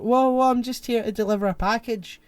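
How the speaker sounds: high, loud startled protest; mock alarm. Delivery guy stopped by a detective, hammed-up alarm. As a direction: play a flustered delivery guy.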